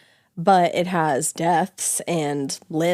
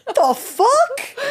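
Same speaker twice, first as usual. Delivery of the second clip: very high incredulous squeal; squeaky disbelief. Retold or imitated. A viewer baffled by the movie, squeaking high. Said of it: "I'm like".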